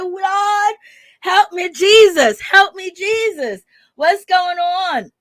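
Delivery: high, wailing cry for divine help; dismayed plea. Retold or imitated. Vlogger reacting to what the rapper said, high dismayed plea. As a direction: call on Jesus in mock alarm. Acted shocked reaction to the interview.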